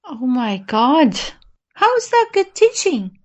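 ordinary delivery

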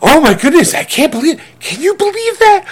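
high, gushing fake surprise; mock shocked wife. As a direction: gasp it out like she's stunned by the twist. Mock wife reacting to plot twist.

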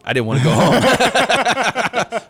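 high laughing delight at camp. Retold or imitated. Telling a friend about camp, laughing high and slow. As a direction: laugh it out like a kid who won't leave.

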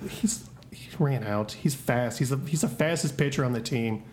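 low, soft and slow; defeated and deflated; dejected. Acted. Dejected father after son flees.

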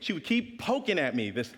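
ordinary talk, nothing marked

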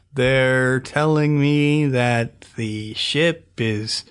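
very slow, unbothered drawl; slow, laid back. Retold or imitated. Imitating the calm engineer on a doomed ship, very slow and laid back. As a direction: stretch it out as if nothing's wrong. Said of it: "incredibly slow back, laid back".

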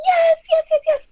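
put-on high, loud, eager kids' yeses; excited kids. Boys agreeing to the Legoland trip, thrilled and high. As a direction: squeal yes like thrilled little boys.

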